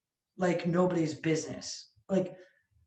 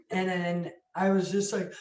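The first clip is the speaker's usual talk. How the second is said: normal talking voice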